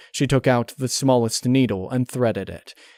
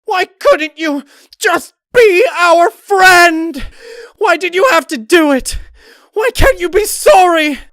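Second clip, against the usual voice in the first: tearful screaming; 12 dB louder than usual. Acted. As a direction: scream out grief and rage. Rarity hacking, crying, screaming.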